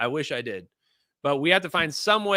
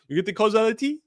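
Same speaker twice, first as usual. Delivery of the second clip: higher, hammed-up French accent; 5 semitones higher than usual. Shown as a demonstration. Host obliging a viewer, higher-pitched mock French accent. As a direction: ask it in a put-on French accent. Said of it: "I don't know if that's a French accent".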